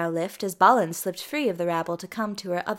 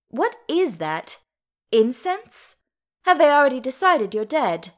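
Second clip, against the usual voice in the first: higher, louder outraged questioning; indignant; 4 semitones higher, 6 dB louder than usual. A narrator's reading. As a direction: demand answers with mounting indignation. Dees indignant at the incense.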